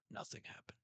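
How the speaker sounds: soft whispered reply; exhausted Zoro shrugging it off; Zoro's rasp. Retold or imitated. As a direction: brush it off in a weak whisper. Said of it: "And Zoro just goes".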